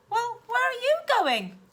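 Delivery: anxious question; girl asking. Acted. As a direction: niece questioning aunt. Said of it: "she asked".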